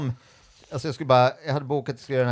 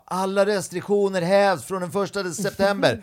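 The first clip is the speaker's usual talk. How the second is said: high, slow announcement call; drawn-out, raised-pitch proclamation; 8 semitones higher than usual. Retold or imitated. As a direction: shout news across a studio. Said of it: "någon bakom kameran som ropar".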